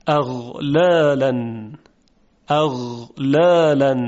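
slow اغلالاً with voiced throaty غ; Arabic ghayn. Shown as a demonstration. Teacher demonstrating a Quranic word for students to copy. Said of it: "دقت کنید به این مثال".

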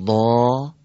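ضا with the a drawn out long and full; long vowel. Shown as a demonstration. Teacher reads the long-vowel take for the class, drawn out. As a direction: stretch the vowel of ضا.

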